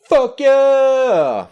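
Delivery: yelled high and loud; high thrilled yell; excited shout. Real, not acted. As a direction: whoop with delight. Excited at game invite.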